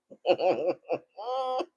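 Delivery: long chuckle rising at the end; rolling, amused laughter; real laugh. Real, not acted. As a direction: laugh at your own telling-off. Laughs after her acted telling-off.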